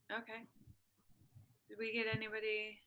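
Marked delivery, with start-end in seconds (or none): none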